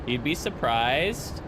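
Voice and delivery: ordinary talk, nothing marked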